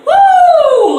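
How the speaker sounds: high, loud party whoop; mock party whoop. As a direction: let out a hyped club whoop. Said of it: "she's like".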